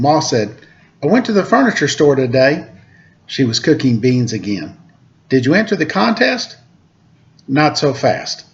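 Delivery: lively read-aloud storytelling voice; storyteller reading a picture book to kids; story narration. A narrator's reading. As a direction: read it to children, bright and clear.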